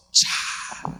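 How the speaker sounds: breathy swoosh sound for a dramatic fall; whooshing throw-down noise. Retold or imitated. Preacher mimics Mary dropping to the ground, breathy swoosh. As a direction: voice the body hitting the floor.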